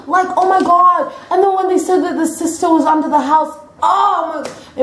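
high, agitated gushing; worked-up retelling. Retold or imitated. Reliving a scary film moment.

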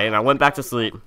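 ordinary talk, nothing marked

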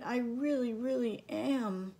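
put-on high small child voice, pleading; a tiny sister saying sorry; little sister voice. A narrator's reading. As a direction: Harriet apologizes to her brother in a small high voice. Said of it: "whispered Harriet".

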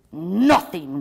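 loud, angry troll outburst; booming grumpy troll; roared. A narrator's reading. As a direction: roar it at them. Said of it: "rolls the Grumble Troll".

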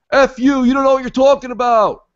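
high, loud mocking snap; mock angry yell. Acted angry doubter.

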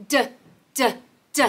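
short, separate "D" sounds; letter-sound demo. Shown as a demonstration. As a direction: sound out "D" as a consonant, like "duh". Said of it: "D sounds like D".

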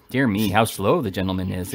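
ordinary delivery

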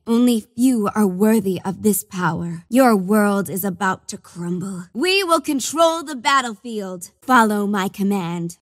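ordinary talk

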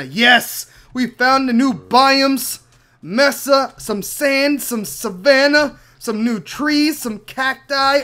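loud, slow, thrilled cheer; savoring each find, calling it out; excited. Real, not acted. Gamer discovering biomes, to viewers, thrilled. Finding new biomes in game.

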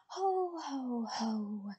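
ho ho ho laugh, ticklish pirate; pirate belly laugh. A narrator's reading. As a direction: laugh out a booming ho ho ho. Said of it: "the boss pirate laughed".

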